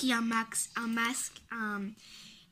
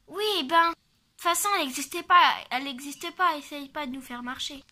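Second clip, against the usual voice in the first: put-on scornful child; 3 semitones higher, 4 dB louder than usual. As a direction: brush it off loudly. Child dismissing the warning.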